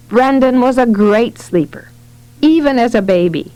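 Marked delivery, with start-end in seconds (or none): none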